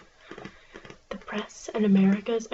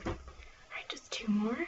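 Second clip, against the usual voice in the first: library whisper; 6 dB quieter, much slower than usual. Acted.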